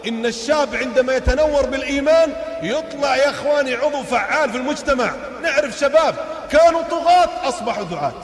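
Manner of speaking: high, loud sermon cadence; fiery preaching. Acted. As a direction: preach it out to the crowd. Preaches at full force.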